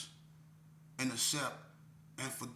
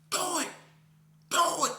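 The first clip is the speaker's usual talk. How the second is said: higher and louder with each repeat; rising, loud dog urging do it; dog egging on; 6 dB louder than usual. Retold or imitated. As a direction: pit bull barking that sounds like do it. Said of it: "the dog was like".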